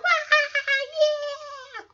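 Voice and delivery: high, drawn-out mock crowd cheer; imitated audience reaction. Host mimicking the audience's reaction, high mock cheering. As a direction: imitate a studio audience whooping. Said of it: "The audience's reaction, they're like".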